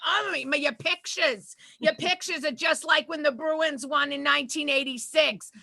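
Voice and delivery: put-on excited Boston-accented doctor; hammed-up Boston accent. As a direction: gush in a broad Boston accent. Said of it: "the doctor's like".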